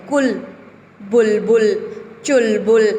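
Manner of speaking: कुल, बुलबुल, चुलबुल with a short quick उ; teacher showing the short उ. Shown as a demonstration. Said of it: "तो हमने ऊ को क्या बोला है? जल्दी बोला है।".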